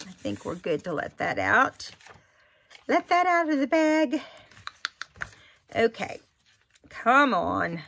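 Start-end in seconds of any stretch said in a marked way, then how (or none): 2.9-4.2 sing-song, teasing lilt; playful high voice. Real, not acted. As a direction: tease it out, lifted and light. Playful aside while crafting.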